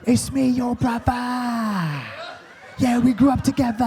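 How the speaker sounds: mock brother's exaggerated British accent; fake British accent. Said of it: "been in the U K for".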